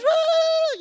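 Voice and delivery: very high, loud imitated "woo"; high falsetto cheer; brother's whoop. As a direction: imitate a thrilled zipline whoop. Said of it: "Then my brother goes".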